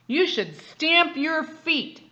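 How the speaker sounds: slow, loud elephant voice. A narrator's reading. Father reading to child, slow loud elephant voice. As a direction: stomp it out slowly like an elephant. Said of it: "That's what the elephant said".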